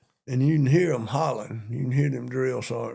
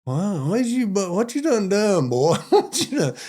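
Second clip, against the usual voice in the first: mock amazed recruit, much higher; astonished; 8 semitones higher, 4 dB louder than usual. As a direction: gasp in disbelief at the scene. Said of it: "I'm looking like".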